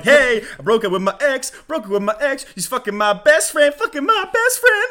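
loud, high-pitched gleeful mock singer; too happy. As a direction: belt out bad news far too cheerfully. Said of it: "This is too happy for being like".

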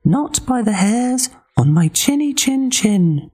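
very high squeaky pig; tiny pig defiant; little pig voice. Acted. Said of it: "he replied".

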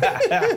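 high burst of laughter; laughing. Real, not acted. Laughing mid-explanation.

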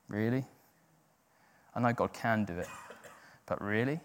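low, soft sceptical questioning; quietly doubtful, lifting on "really"; cynical doubt. Acted. Preacher voicing our cynical heart, cynical doubt. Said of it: "our cynical heart goes".

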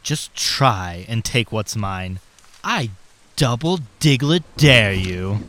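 low, menacing villain growl; villain taunt. Acted. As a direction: sneer it like a cartoon villain. Villain Mike taunting Agent J.

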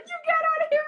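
high, loud mock order to leave; scolding mom. Daughter imitates mom clearing her pub, scolding. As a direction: imitate mom throwing someone out, pitch way up.

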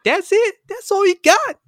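very high, loud scoffing; screeching teen taunt; mock Raphael. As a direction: shriek it at the old rat. Raphael mocking Splinter.

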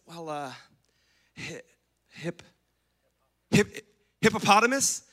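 hesitant stammer into a doubtful guess; Adam unsure what to call the animal, stammering. Retold or imitated. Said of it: "he says".

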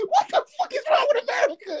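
shouting through tears of laughter; laughing outcry. Real, not acted. Friend crying with laughter at the statement. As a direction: crack up while yelling at America.

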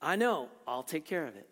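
gentle son. Pretend. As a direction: soothe her, very gently. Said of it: "He could have said very gently".

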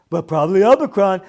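mock him like a six-year-old; mocking childish voice. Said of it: "What are you, six years old talking like this?".

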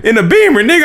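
high, laughing insistence; laughing mock protest. Imitating his friend's defence about music in the car, laughing protest.

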